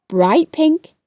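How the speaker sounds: ordinary delivery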